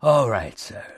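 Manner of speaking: breathing heavily. A narrator's reading. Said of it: "he breathed heavily".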